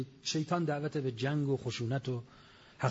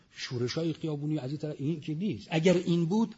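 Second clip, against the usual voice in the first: ordinary delivery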